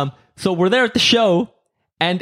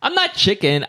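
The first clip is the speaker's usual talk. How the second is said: ordinary delivery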